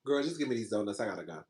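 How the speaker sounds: very low, soft retold surrender; resigned. Customer giving up on the donut order, quietly resigned. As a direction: give in under your voice, pitch dropped right down.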